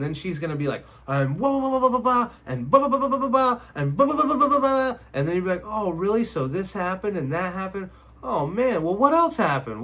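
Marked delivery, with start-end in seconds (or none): 1.1-5.0 high, loud babbling blahs; mock venting. Wife unloading her problems on her husband, mock venting. Mimics a woman venting at length. 5.6-9.8 raised, louder attentive-listener voice; show of interest. Shown as a demonstration. Husband echoing his wife's story back, with a show of interest. Acts husband prompting her to continue.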